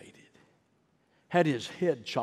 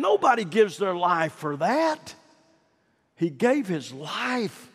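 much higher, louder, slowing to drive it home; preaching at full force; 7 semitones higher, 6 dB louder than usual. Acted. Preacher to congregation on Peter's martyrdom, rising preaching. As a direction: build to a rousing pulpit climax.

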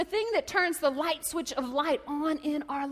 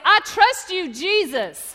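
loud, raised rallying shout; shouted declaration; 5 semitones higher, 9 dB louder, much slower than usual. Shown as a demonstration. Preacher leading the congregation to shout back, loud declaration. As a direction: shout it for everyone to echo. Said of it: "So shout right now".

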